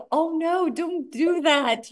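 high, drawn-out dismayed plea; mock dismay. Coach reacting to her team's pushy pitch, mock horror. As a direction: wail a playful warning, stretching it out.

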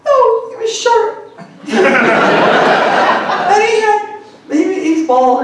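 high, slow mock correction through a laugh; grieving girlfriend. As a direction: answer like the tearful girl, hammed up. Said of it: "She's like".

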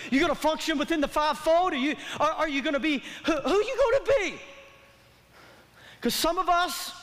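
ordinary talk, nothing marked

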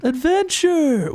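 high, slow cry of adventure; drawn-out cry. Pretend. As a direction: stretch it out like a hero's call. Repeats the catchphrase.

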